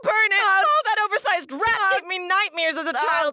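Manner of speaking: high-pitched shrieking panic; hammed-up cries of revulsion; mock horror. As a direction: shout and wail in exaggerated fright. Hammed-up horror at the rat creature.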